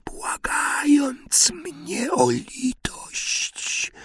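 high, soft wailing whisper; a spectre hissing a threat; eerie whisper. A narrator's reading. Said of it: "warknęła twarz". (wysoki, upiorny szept)

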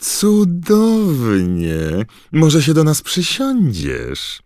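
high, drawn-out sugary gush; beaming gossip voice. Acted. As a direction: gush with fake delight. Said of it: "uśmiechając się promiennie".

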